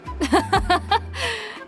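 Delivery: high, light burst of laughter; amused laugh. Acted. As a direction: laugh at the idea before answering. Amused reply to the joke.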